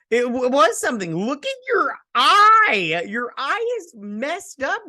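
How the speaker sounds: high, loud, slow squeal over his ruined eye; mock shriek of alarm. Narrator mocking Valentine's damaged eye, in a high hammed-up squeal. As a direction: gasp it out like you're grossed out and thrilled.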